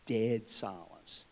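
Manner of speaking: Dead silence, very slowly, hushed; drawn-out dramatic echo; slow hushed repeat. Shown as a demonstration. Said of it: "It was dead silence".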